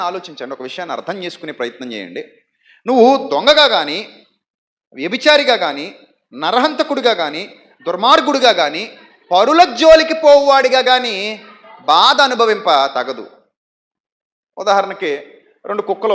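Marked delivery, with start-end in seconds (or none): 2.9-13.3 loud, slow preaching. Real, not acted. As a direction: hammer each word from the pulpit. Preaching at full force.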